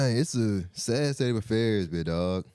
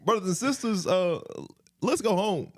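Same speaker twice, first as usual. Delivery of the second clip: a lightly raised sermon voice; mock preacher; 9 semitones higher than usual. Preacher tells the sweating church to go home, mock sermon. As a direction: preach an early dismissal, tongue in cheek.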